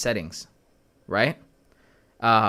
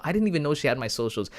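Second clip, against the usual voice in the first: ordinary talk, nothing marked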